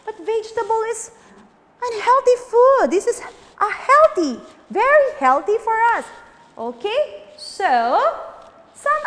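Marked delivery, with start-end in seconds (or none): 0.1-6.1 high and loud, insisting; teacher insisting veggies are healthy; emphatic. Real, not acted. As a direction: push back on the yucky idea. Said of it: "correcting the kids".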